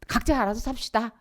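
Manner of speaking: high, loud mock declaration; defiant child. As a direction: announce it cheekily, high and loud. 독립 선언하는 자녀 흉내. (당돌하게 선언하는 말투)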